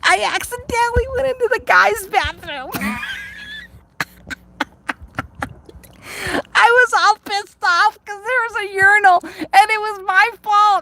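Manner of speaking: high, breaking into laughter through the words; can barely get it out for laughing; laughing while telling. Real, not acted. Confessing the men's room mix-up, laughing hard.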